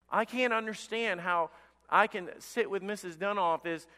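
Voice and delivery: ordinary talk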